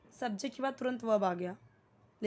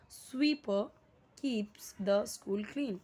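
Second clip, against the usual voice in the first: slow model sentence; much slower than usual. Shown as a demonstration. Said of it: "इसे sweeper नहीं पढ़ेंगे".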